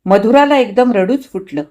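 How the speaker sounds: usual speaking voice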